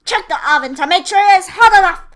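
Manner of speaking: fast, high, loud witch order. A narrator's reading. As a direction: rush her toward the oven. Witch pushing Gretel to the oven.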